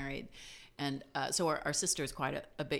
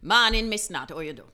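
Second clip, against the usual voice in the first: put-on Jamaican accent; 3 semitones higher, 9 dB louder than usual. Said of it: "I'll be like".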